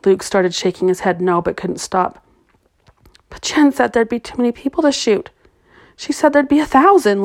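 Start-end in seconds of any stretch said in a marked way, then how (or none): 3.3-7.2 desperate protest. A narrator's reading. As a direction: push back, voice climbing in panic. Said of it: "Luke protested".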